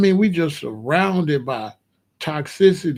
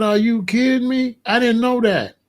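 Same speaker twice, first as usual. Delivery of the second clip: retold shocked reaction, pitched up high; shocked disbelief; 6 semitones higher than usual. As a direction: replay your own stunned disbelief. Retold reaction to new info.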